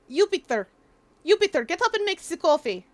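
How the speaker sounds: loud TV Eastern European accent; fake Eastern European accent. Shown as a demonstration. Streamer demos accent by ordering coffee, loud fake Eastern European.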